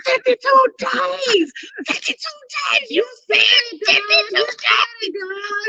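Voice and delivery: squealed cry to God, acting out the moment; retold screaming. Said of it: "I was screaming".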